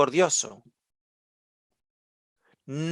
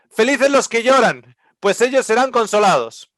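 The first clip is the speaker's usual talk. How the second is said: lifted, louder proclaiming of scripture; preaching at full force; 6 semitones higher, 4 dB louder than usual. Acted. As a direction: proclaim the verse to the crowd. Preaching the beatitudes. (predicador proclamando con fuerza)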